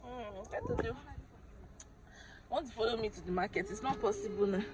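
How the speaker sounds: normal talking voice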